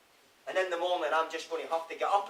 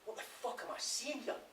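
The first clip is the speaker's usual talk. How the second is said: stunned whisper; 5 semitones higher, 13 dB quieter than usual. Acted. Stunned thought, seeing the blood.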